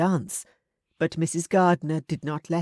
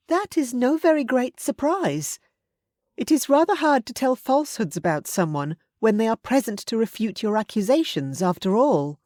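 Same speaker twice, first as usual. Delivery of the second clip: put-on higher, bright young woman's voice; Elizabeth; 7 semitones higher, 3 dB louder than usual. A narrator's reading. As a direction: voice Elizabeth a little higher and louder.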